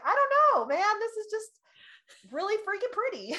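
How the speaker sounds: very high and loud, bursting with delight; answering her husband in a giddy squeal; giddy overwhelm. Retold or imitated. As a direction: squeal it, helpless with how pretty it is.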